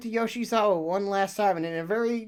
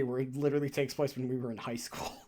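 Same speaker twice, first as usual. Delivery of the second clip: whispered aside; 5 semitones lower, 9 dB quieter, faster than usual. Real, not acted.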